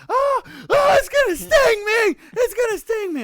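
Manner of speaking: very high, loud panicked shriek; imitated screaming. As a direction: shriek like a terrified little brother. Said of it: "we just start hearing screaming".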